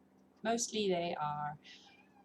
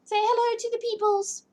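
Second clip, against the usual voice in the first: pet talk; 6 dB louder than usual. Real, not acted. Owner showing her cat to the stream, baby talk. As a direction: coo at your cat.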